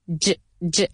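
"J, j" as a short voiced ch sound. Shown as a demonstration. Teacher isolating the ending, "J, j" like a buzzed ch. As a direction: voice the final sound of strange, "J, j". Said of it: "como si fuera una C H".

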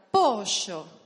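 "Pollo" very loud, stretched into pooosho; Argentine sh. Shown as a demonstration. Teacher trying another word on the class, Argentine sh. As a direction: punch out "Pollo" with ll as a long airy sh. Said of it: "Probemos otra palabra".

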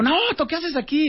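high, drawn-out retold astonishment; surprised greeting. Speaker runs into Naoto at the course, surprised greeting.

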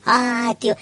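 normal talking voice

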